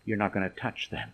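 low, soft, stubborn refusal; self-assertive. Acted. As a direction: mutter a refusal under the breath. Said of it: "Peter's self-assertiveness".

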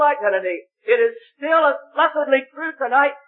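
high, ringing sermon climax; full-force preaching. Acted. Preacher urging the gospel meeting, full-force preaching. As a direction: preach it out to the whole hall.

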